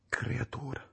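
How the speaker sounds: hushed whisper on creatura; whispered word. A narrator's reading. Narrator whispering creatura in dread. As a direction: breathe creatura in fear.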